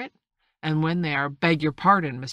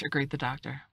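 ordinary delivery, nothing marked

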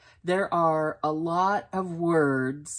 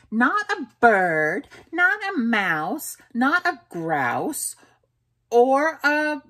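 Mama Junebug lecturing her kitten; lecturing mother; 6 semitones higher, 4 dB louder than usual. Acted. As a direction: drill the list into him word by word. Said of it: "said his mother".

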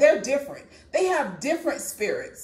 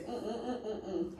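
soft moved humming; 4 semitones lower, 15 dB quieter than usual. Real, not acted. Preacher hums, moved.

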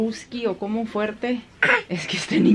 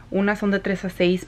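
ordinary talk, nothing marked

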